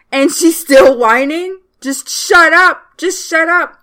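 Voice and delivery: loud, high-pitched exasperation; exasperated rant. Real, not acted. Fan fed up with a whining character, loud exasperation. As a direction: yell at the TV in exasperation.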